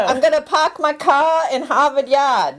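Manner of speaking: put-on high, R-dropping Boston accent; New England accent. Playing a local for the co-host, New England accent. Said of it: "That's where you're from".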